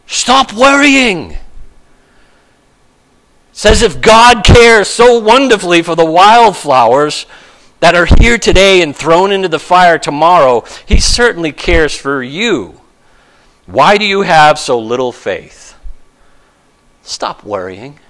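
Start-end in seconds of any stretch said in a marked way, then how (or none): 0.1-1.4 slow, drawn-out stop worrying; a slow, commanding plea; firm command. Acted. Preacher commands the congregation. 17.0-18.0 much lower and far softer, almost hushed; a hushed pastoral echo; soft repeat. Acted. Repeated softly to the congregation.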